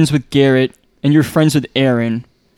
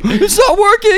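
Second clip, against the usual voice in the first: high laughing squeal; 4 dB louder, slower than usual. Real, not acted. Laughing at failed trick.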